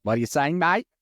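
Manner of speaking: hammed-up Australian, rising sharply at the end; bad Australian accent. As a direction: put on a terrible Australian accent. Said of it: "the worst Australian accent ever".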